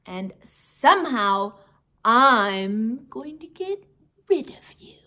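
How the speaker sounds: threaten, then hiss the end. Acted. Teacher threatening the hamster, loud then whispered.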